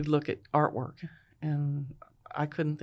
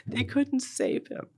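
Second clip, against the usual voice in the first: high, slow, tearful voice; breaking down; 10 semitones higher than usual. Real, not acted. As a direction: choke out the news, voice rising. Mother retells news of death.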